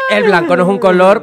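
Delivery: loud playful protest at the guest's answer; loud teasing protest. Real, not acted. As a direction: call out the joke loudly. Teasing guest's favourite color.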